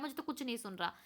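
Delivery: ordinary delivery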